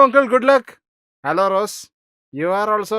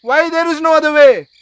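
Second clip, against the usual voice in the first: higher, louder indignant outburst; angry protest; 6 semitones higher, 4 dB louder than usual. Acted. Groom protesting to his mother that he cannot decide, furious. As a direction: rage against being forced.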